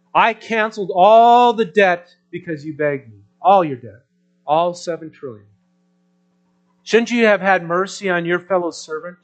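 loud rebuke that builds; angry master. A narrator's reading. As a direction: scold the wicked servant loudly. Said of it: "In anger".

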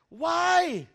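high, loud gasping what; shocked disbelief. Retold or imitated. Teacher hears his student's mother was murdered, shocked high cry. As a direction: recoil in disbelief at the news. Incredulous why.

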